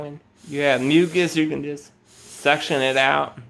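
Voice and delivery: usual speaking voice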